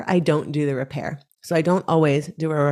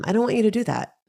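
lightly voiced young boy, higher; higher pitch, lightly imitating a child objecting; a hint of a little kid's objection; small child; 7 semitones higher than usual. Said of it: "Or he might say".

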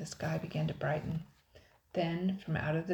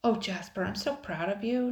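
higher, louder gush of pride; quiet raving; 4 semitones higher, 5 dB louder than usual. A narrator's reading. Sister praises Jasper after the rescue, proud raving. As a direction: gush proudly over a brother. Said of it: "Nicole raved quietly".

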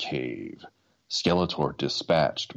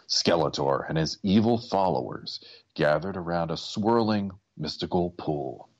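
plain reading voice

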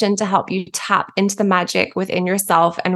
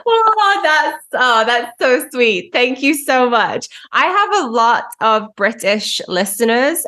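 usual speaking voice

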